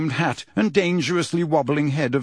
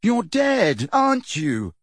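high, exasperated challenge; 8 semitones higher, slower than usual. A narrator's reading. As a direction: challenge him, out of patience. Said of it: "said Harry exasperatedly".

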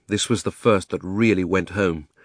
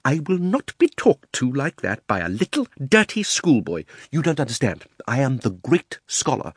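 indignant self-important uncle, rising in pitch; pompous Uncle Andrew; 6 semitones higher, 3 dB louder than usual. Acted. Uncle boasts to dirty schoolboy, pompously indignant. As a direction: puff yourself up, building to high outrage.

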